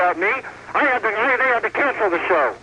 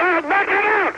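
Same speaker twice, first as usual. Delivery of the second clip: raised, punchy threat; 8 semitones higher than usual. Real, not acted. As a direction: shout the threat higher. Boasting, escalating shout.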